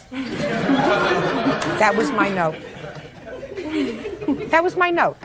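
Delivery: laughing through the words; amused, laughing disbelief. Real, not acted. Judge laughs at her note.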